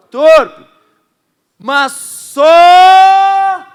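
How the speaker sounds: slow, loud and high scripture read; preaching full force. Acted. Pastor proclaiming Ephesians to the church, loud and slow. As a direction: thunder each word out slowly.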